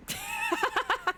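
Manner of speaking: a very high, soft giggle after the crack. Real, not acted. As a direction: laugh it off up high. Laughs after her voice cracks.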